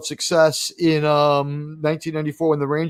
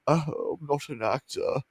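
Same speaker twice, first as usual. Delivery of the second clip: mock dejected actor; 2 semitones lower, 9 dB quieter than usual. Host mocking a bereft character after a bad interview, mock dejection. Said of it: "and he's like".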